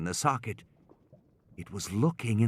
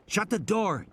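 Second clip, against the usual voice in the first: put-on higher, urgent boy's order; a hint of an urgent boy; 9 semitones higher than usual. Tim tells his sister to close the door, urgent boy. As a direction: tell her quickly, like a scared boy. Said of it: "Tim said".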